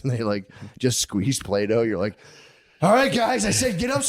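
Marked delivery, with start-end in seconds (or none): 2.8-4.0 high, loud parental snap; angry yell. Retold or imitated. Angry parent ordering kids upstairs, a high, loud bark. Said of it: "You get so fucking mad".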